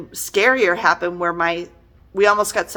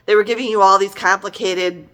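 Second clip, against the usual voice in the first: ordinary talk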